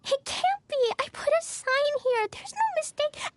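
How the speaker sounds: high, shocked cries; can't believe it's gone; dismayed disbelief. Acted. Child shocked box missing.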